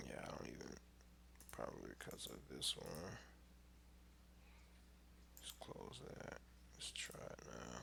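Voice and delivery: very soft, half-whispered muttering; talking to himself. Real, not acted. Trader puzzling over his chart list to himself, muttering. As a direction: think aloud under your breath, barely audible.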